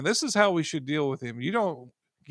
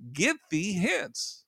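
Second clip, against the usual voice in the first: slow commanding rebuke; 4 semitones higher, much slower than usual. Acted. Preacher voicing Jesus rebuking devil.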